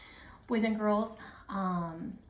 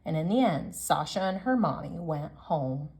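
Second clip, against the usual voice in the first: lively picture-book read-aloud, louder than her chat; reading to children; 6 dB louder than usual. Acted. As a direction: read it out to the class like story time. Teacher reads picture book to class.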